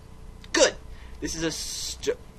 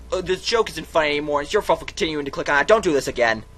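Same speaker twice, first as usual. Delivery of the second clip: fast, loud, irritated scolding; annoyed telling-off; 5 semitones higher, 8 dB louder, much faster than usual. Acted. Narrator scolds the player for clicking again, fast and loud. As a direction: tell them off quickly and loudly.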